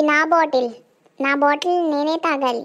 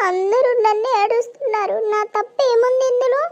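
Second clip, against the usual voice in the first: crying; 6 semitones higher than usual. Acted. Son crying at mother.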